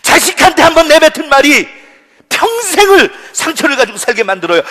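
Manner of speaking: loud, high, impassioned preacher; full-force preaching. Real, not acted. Preacher warning church members against habitual anger, full-force preaching. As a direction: thunder the warning at the congregation.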